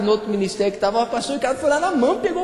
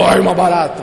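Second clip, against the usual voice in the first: loud, full-force cockroach yell; mock scream; 4 semitones lower, 9 dB louder than usual. Shown as a demonstration. Preacher shows congregation how they really screamed, loud mock yell. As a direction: bellow it in exaggerated alarm. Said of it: "Você gritou".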